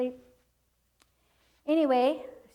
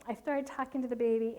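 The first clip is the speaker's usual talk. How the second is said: ordinary talk, nothing marked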